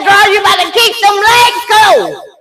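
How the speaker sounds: high loud scolding command; stern loud order. Retold or imitated. Mother orders laboring daughter in truck, high loud scolding. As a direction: order her like a drill sergeant. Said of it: "I said".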